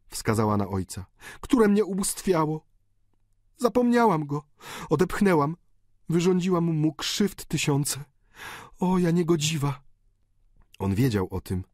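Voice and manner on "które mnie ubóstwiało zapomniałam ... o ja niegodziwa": high, desperate daughter's plea over a dying father; countess pleading. A narrator's reading. Countess begging her dying father for blessing, desperate high plea. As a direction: beg him to come back, voice high and torn.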